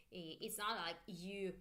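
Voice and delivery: ordinary talk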